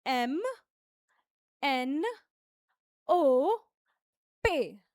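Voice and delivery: French letters said crisply one by one; teacher repeating French letters; French letter names. Shown as a demonstration. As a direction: model each French letter for students. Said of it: "फिर से repeat करेंगे".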